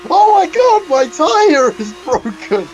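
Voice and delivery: very high, loud mock screech; Fexelon impression. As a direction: screech in panic over a broken tire, hammed-up. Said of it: "that was my Fexelon, um, impression".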